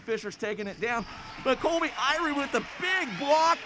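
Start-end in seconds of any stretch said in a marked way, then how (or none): 1.4-3.6 higher, louder call of the big block; excited shout. Real, not acted. Big block in live game.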